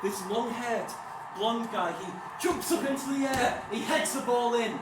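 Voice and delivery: breathless-with-thrill match moment; excited goal retelling. Acted. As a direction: relive the goal as it happens. Reliving the goal as it happens.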